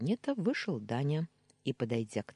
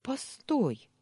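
blurted out; 11 semitones higher than usual. A narrator's reading. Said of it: "выпалила я".

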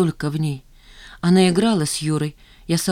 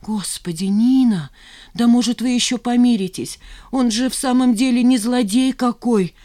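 put-on higher, pleading fretful old mother; 6 semitones higher, 3 dB louder than usual. Mother pleading with Nina over Yura, pleading. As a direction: beg her to make peace. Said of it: "забормотала Раиса Романовна".

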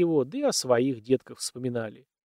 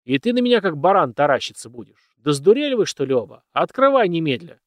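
angry scolding; 4 semitones higher, 6 dB louder than usual. Acted. Dead master scolds servants.

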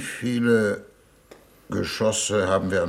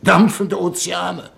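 indignant outburst; 9 semitones higher, 6 dB louder than usual. Acted. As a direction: work yourself up into a furious tirade. Envoy's indignant outburst against Venus.

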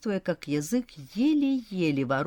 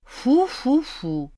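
slow, mock-tender blowing; blowing on a wound; 8 semitones higher, 5 dB louder, much slower than usual. A narrator's reading. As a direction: blow gently like soothing a child. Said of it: "Могу подуть и пожалеть".

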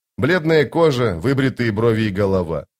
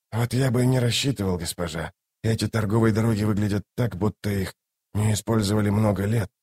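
dry caution, voice held down; sceptical male companion; 6 dB quieter than usual. Acted. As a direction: answer the lady quietly, unconvinced. Voices Tok in dialogue.

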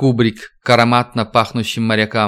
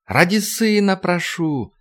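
slow, high, sobbing plea; begging through tears; tearful plea; 7 semitones higher, slower than usual. Acted. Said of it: "Слова утонули в рыданиях".

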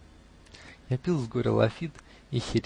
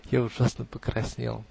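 ordinary delivery

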